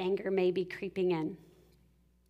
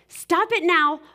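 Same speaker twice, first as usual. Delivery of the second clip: higher, louder sharp command; stern yell; 8 semitones higher, 9 dB louder, slower than usual. Retold or imitated. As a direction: snap at the kid to stop. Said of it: "something you might say is like".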